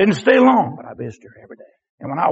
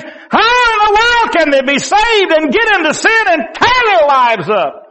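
high, incredulous pulpit cry; anguished preaching; 12 semitones higher, 5 dB louder than usual. Real, not acted. Preacher asking how believers fall into sin, anguished incredulous preaching. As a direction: build the bewildered questions to a shout.

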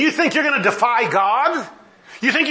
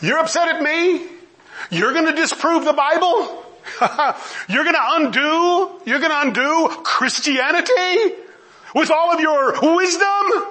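high, mocking disbelief with scornful amusement; incredulous God; 8 semitones higher than usual. God answering people who would undo Christianity, incredulous. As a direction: question them as if their challenge is laughable. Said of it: "He says".

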